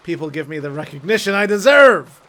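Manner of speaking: hammed-up megalomaniac outburst; mock triumph. Joking as a pet killer finally recognized, loud mock triumph. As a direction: build to a loud villainous declaration.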